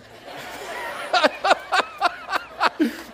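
high-pitched comic sobbing; mock hysterical crying. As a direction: wail in hammed-up hysterics. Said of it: "cried hysterically with them".

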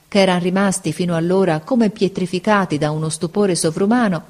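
plain reading voice

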